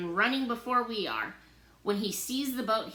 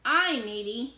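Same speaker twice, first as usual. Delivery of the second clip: pirate voice; 3 semitones higher, 5 dB louder than usual. A narrator's reading. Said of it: "Henry sounds like a pirate".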